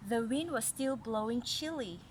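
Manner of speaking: ordinary delivery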